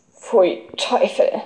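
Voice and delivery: slow, muted ugh of distaste; disgusted murmur. A narrator's reading. Boy told he must eat fish, disgusted murmur. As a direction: recoil from the very thought. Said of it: "murmelte Emil und verzog das Gesicht".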